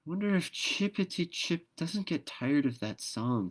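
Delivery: put-on high child's voice, musing; lightly voiced curious child; little girl Dot. A narrator's reading. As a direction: wonder aloud like a small girl. Dot speaking in story.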